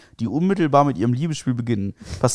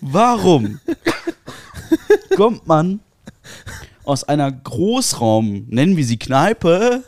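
disbelieving, raised voice; incredulous; 5 semitones higher, 4 dB louder than usual. Real, not acted. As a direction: ask it in total disbelief. Incredulous outraged question.

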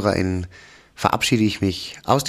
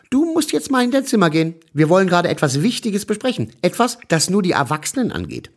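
higher, firm mother voice; 7 semitones higher than usual. A narrator's reading. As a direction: send the child off as mum. Mama schickt Kind weg. (bestimmte Mutterstimme, höher)

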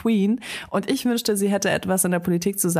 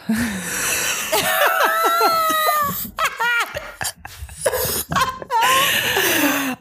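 long high shrieking laughter. Real, not acted. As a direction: burst out laughing helplessly. Long laugh fit at slip.

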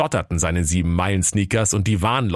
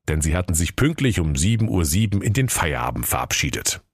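plain reading voice

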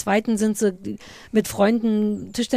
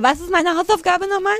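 clueless self-quote; 8 semitones higher, 3 dB louder than usual. Retold or imitated. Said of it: "dass ich dir ... sage".

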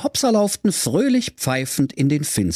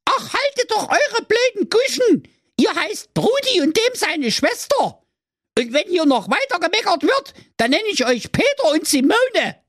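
much higher scolding stepmother voice; wicked stepmother. Acted. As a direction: scold like a cartoon witch. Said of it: "Da keifte die böse Stiefmutter".

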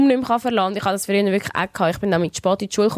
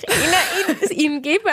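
laughing; 7 semitones higher, slower than usual. Real, not acted. Laughing mid-sentence.

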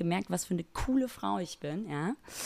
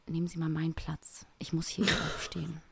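secretive whisper into someone's ear; hushed, unvoiced imitation of a discreet stranger; 4 semitones lower, 7 dB quieter than usual. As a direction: whisper it like a covert tip-off. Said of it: "sie hat geflüstert, mir ins Ohr".

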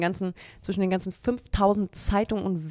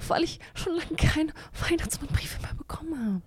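whispering; 8 semitones higher, 6 dB quieter than usual. Acted. Mock sad complaint.

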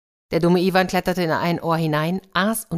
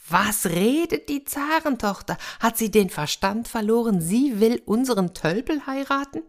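much higher, indignant disbelief; outraged brothers; 7 semitones higher than usual. Acted. Jealous brothers hear the princess choose Iwan, shocked disbelief. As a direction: squeal it out, unable to believe it.

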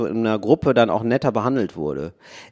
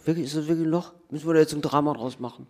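softer, doubting landlord voice; skeptical inspector; 6 dB quieter, faster than usual. Acted. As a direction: quietly question the hole like a picky inspector. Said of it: "Der Endabnahme der Wohnung sagt". (skeptischer Vermieter, leiser)

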